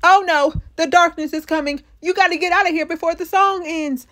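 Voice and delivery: frantic warning. Retold or imitated. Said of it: "Martin's over there on the wall talking about".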